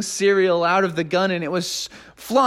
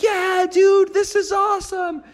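much higher surfer-dude cheer; stoked dude; 14 semitones higher than usual. Acted. Neighbors cheering under the milk rain, high surfer-dude whoop.

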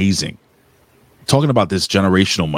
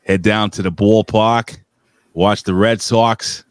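Boston accent. Shown as a demonstration.

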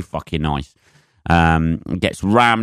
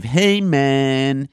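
slow, higher, spaced-out hippie drawl; laid-back hippie; 5 semitones higher, much slower than usual. Retold or imitated. Lightly voiced hippie from the audience telling him to live life. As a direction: imitate a chilled-out alternative guy. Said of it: "one of these alternative".

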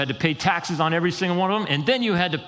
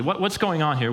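ordinary talk

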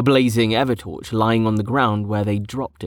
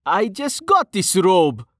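high, drawn-out whine; complaining; 9 semitones higher, slower than usual. A narrator's reading. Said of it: "he complained".

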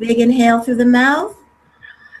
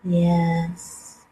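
guided meditation; 3 semitones lower than usual. Acted. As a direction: calmly guide them, unhurried. Leads a guided breathing meditation.

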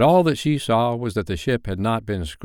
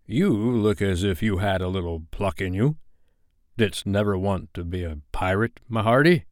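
put-on single out a boy as the menacing captain; Captain Hook. Hook addressing John, menacing pirate voice.

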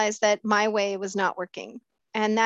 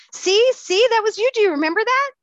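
excited; 12 semitones higher, 7 dB louder than usual. Retold or imitated. Husband showing wedding album.

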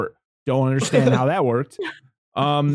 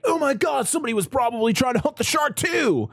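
high-pitched imitated dismay; exaggerated alarm, pitched way up; mock alarmed boaters; 9 semitones higher than usual. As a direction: voice the other boat in a high, hammed-up panic. Voicing people on the other boat.

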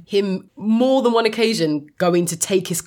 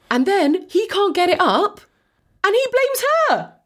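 much higher, disbelieving squeal of indignation; incredulous outrage; 11 semitones higher than usual. Real, not acted. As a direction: recount it as if you can't believe the cheek. Outraged retelling of the plot.

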